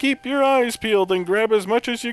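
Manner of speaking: hammy put-on professor read; terrible Egad voice. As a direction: chatter like an excitable cartoon scientist. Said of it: "That voice is terrible".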